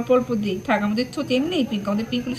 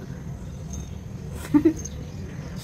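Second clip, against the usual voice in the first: soft airy giggle; a quiet breathless laugh; hushed giggling under the breath; breathy giggle; 3 semitones higher, 7 dB quieter than usual. Real, not acted. Laughing at the child.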